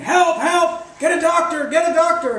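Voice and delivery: high, loud mock-panicked screams; retold screaming for help. Storyteller voices bystanders screaming for a doctor, high panicked shouts. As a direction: cry out like an alarmed crowd. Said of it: "everybody's screaming".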